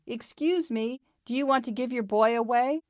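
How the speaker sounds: high-pitched call to get someone's attention. A narrator's reading. Puppy asking a collie for his boy, calling out. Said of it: "He called out to the collie".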